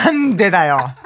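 loud, high mock-outrage; indignant comeback. Comedian protesting his partner's teasing, in loud mock indignation. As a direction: snap back at the absurd joke.

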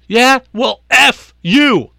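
high, loud scream of F you. Retold or imitated. Tossed coach screams at the ump while flipping the bird. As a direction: spit it out as a final insult. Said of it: "he screams at the guy".

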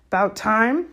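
gruff-mannered gamekeeper, impatient. A narrator's reading. Hagrid greeting the late arrivals, impatient. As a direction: grumble at being kept waiting.

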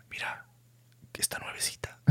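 hushed conspiratorial whisper. Retold or imitated. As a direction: whisper it like a secret find. Papá susurra sobre la pieza. (susurro cómplice)

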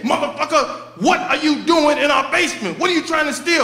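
angry demand. Retold or imitated. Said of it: "he said again".

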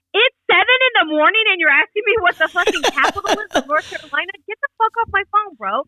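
high, exasperated mock rant at a caller; half-yelled grumpy brush-off; annoyed retort. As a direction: play it as being woken too early and fed up. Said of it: "I'm all".

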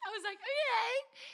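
high laughing talk; giggling high recap. Real, not acted. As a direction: laugh through the words. Laughing at her own story.

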